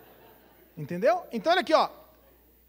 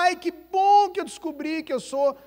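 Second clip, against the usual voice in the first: mock cheerful reply; 8 semitones higher, 7 dB louder than usual.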